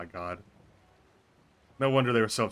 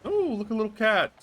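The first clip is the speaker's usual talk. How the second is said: higher, squealing with delight at a cat; 11 semitones higher than usual. Real, not acted. Streamer spotting a cat in town, excited coo. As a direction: coo over the little cat, pitched up.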